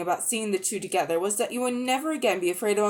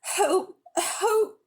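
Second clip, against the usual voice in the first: high, desperate pleading cries; faint cry for help; 12 semitones higher, 4 dB louder, much slower than usual. A narrator's reading. The witch begging the children not to leave her, high and desperate. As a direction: beg for mercy as you weaken. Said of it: "cried the witch in a faint voice".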